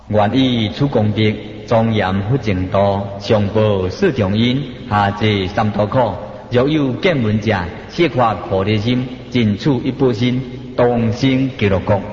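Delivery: low, measured Buddhist recitation; solemn chant-like reading of a merit dedication; dedication verse recitation. Shown as a demonstration. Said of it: "念回向文".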